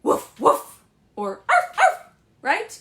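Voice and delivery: loud playful barking woof and arf; mock dog bark. Shown as a demonstration. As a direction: bark it out for the children. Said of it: "but what do dogs say?".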